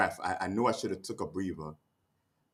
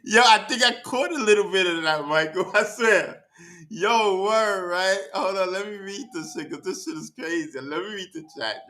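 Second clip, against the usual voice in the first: much higher and louder, bubbling with laughs; riding the high of the moment; giddy laughter; 12 semitones higher, 5 dB louder than usual. Real, not acted. Streamer answers Michael in chat, giddy and laughing.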